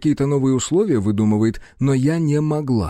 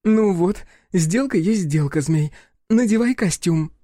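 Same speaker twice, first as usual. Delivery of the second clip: forced cheerfulness; 7 semitones higher than usual. Acted. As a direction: cover embarrassment with fake cheer. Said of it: "нарочито весёлым тоном".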